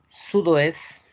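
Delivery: French Sudoeste ending on S; silent T. Shown as a demonstration. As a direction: say Sudoeste without its final T. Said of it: "La T no se pronuncia".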